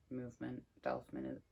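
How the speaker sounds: ordinary delivery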